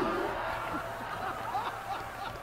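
high, wheezy laugh trailing away; breathless laugh. Real, not acted. Storyteller after the cow punchline, breathless laugh. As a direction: laugh helplessly at your own punchline.

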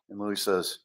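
ordinary delivery, nothing marked

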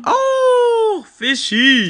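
high, loud delighted squeal; squealing with joy; excited squeal. Acted. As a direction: gasp with glee at the fish. Excited cry at fish.

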